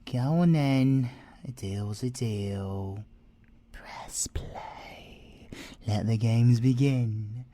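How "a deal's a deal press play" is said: slow voiceless whisper, fading to almost nothing; conspiratorial whisper. Acted. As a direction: seal the deal in a hush. Conspiratorial aside to inspector.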